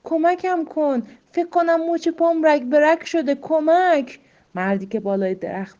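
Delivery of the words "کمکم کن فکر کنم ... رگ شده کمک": high, loud desperate call; crying for help. Acted. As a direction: shout up for help from the ground. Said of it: "فریاد زد".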